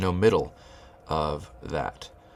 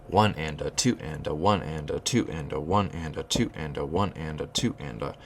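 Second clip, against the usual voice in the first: one and a, two and a, with ONE and TWO leaned on; steady counted chant, downbeats leaned on; counting with downbeat stress. Shown as a demonstration. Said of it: "You can hear each downbeat".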